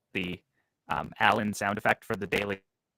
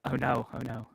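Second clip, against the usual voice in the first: robotic voice; 4 semitones higher, 5 dB quieter than usual. Real, not acted. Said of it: "you sound robotic".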